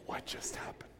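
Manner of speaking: whispering in shock; stunned whisper. Retold or imitated. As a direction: breathe it out, no voice at all. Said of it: "quiet, staring at me".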